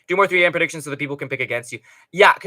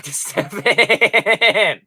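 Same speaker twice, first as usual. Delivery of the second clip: laughs through the words, slowed; can't hold it together reading chat; breaking into laughter; laughing; 6 semitones higher, 7 dB louder, much slower than usual. Real, not acted.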